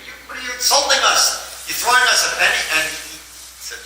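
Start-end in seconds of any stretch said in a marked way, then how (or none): 0.0-2.6 high, indignant mock outburst; outraged at the insult, pitched up; wanting to scream. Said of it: "they wanted to scream".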